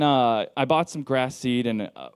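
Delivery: usual speaking voice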